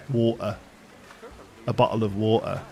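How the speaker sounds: "bottle of water" with a glottal stop; slight glottal stop. Shown as a demonstration. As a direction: drop the T in "water", slight catch. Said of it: "a very slight glottal stop instead".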